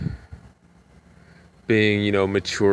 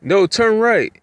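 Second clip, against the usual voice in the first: high, louder sudden order; old lady ordering; 12 semitones higher, 5 dB louder than usual. Retold or imitated. Passenger telling the driver to turn, high loud order. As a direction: bark the direction in her high voice. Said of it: "she's like".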